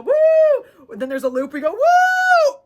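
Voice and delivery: very high, loud woo; coaster whoop. Real, not acted. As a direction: scream down the first hill. Coaster hills and loop.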